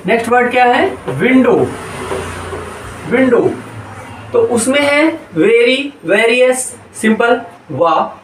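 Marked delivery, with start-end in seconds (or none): 1.1-3.5 विंडो with lips rounded on the opening W. Shown as a demonstration. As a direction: round the mouth to begin each विंडो. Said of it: "मुंह का pronunciation गोल होना है".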